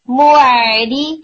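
موری said very slowly, ain unrounded as if with fatha; relaxed ain. Shown as a demonstration. Tutor showing students the unrounded ain, slowly. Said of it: "آئین کی sound کے اوپر effect نہیں آئے گا".

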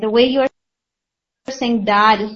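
دال drawn out slowly; slow, careful دال; letter demo. Shown as a demonstration. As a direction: linger on دال to show its sound. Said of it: "یعنی اد".

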